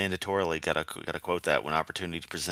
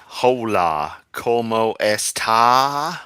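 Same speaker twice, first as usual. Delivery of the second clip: put-on slow, halting Texan-accented Spanish; mock a Texan mother trying Spanish; imitating mom's Spanish; 2 semitones higher, 6 dB louder, much slower than usual. Teasing how Laura's mother tries Spanish, in a Texan accent. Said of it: "we should have heard her mother try to speak Spanish".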